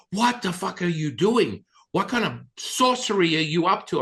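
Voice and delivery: loud, high, shocked demand; retold alarmed yelling. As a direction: act out her freak-out at full pitch. Retold friend's alarmed outburst.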